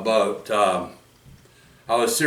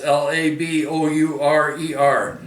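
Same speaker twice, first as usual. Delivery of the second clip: spelling it out. Real, not acted. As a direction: spell each letter out carefully. Spelling out a word for viewers.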